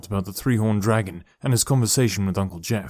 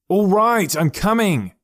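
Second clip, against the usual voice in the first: much higher, louder annoyed shout; cross yell; 10 semitones higher, 5 dB louder, slower than usual. Acted. Boy yells crossly from bed at the banging, loud and annoyed. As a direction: bellow back in irritation. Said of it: "he yelled crossly".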